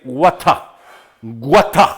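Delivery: British "water" with a hard T and no final R. Shown as a demonstration. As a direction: say "Water" the British way, ending in an open "ah". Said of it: "en Inglaterra, el Reino Unido". ("water" con acento británico, sin R final)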